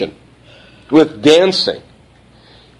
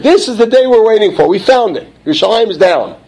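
gloating enemies; 7 semitones higher, 3 dB louder than usual. Retold or imitated. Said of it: "They're saying".

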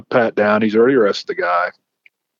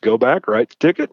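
normal talking voice

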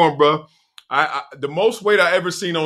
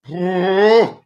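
heavy sigh; 7 semitones higher, 4 dB louder than usual. Real, not acted. Regret after weight gain.

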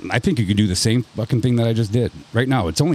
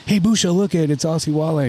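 put-on kids teasing grandma; 6 semitones higher than usual. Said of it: "my kids will come".

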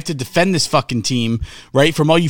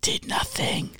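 breathy whisper under the breath; whispered aside; 11 dB quieter, slower than usual. Real, not acted. Co-host muttering agreement, a whisper. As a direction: whisper it to yourself.

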